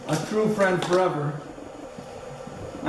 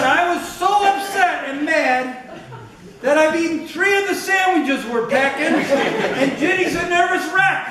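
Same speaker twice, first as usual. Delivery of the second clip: retold upset Ruff; 9 semitones higher than usual. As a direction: mimic the friend's exasperated confession. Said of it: "He said".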